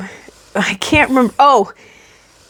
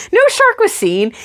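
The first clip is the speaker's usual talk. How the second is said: normal talking voice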